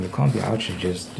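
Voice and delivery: usual speaking voice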